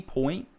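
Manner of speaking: "point" said questioningly as British "pint"; questioning "point", spelt-out oy sound. Shown as a demonstration. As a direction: test "point" with an oy vowel, rising. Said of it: "is it point, like".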